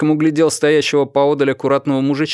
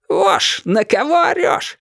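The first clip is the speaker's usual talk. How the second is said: high, squealing challenge; squealed taunt; 9 semitones higher, slower than usual. A narrator's reading. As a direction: squeal it at him, climbing very high. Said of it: "взвизгнул капитан".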